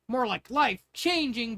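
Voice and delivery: put-on a girl beaming at her father. A narrator's reading. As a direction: let the words stretch out, glowing with pride. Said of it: "beamed Pearl".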